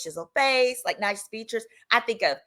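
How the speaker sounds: ordinary talk, nothing marked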